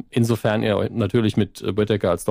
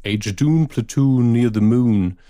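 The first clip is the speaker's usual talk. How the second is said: slow English catchphrase; English quote; 3 dB louder, slower than usual. Retold or imitated. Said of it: "als es irgendwann hieß".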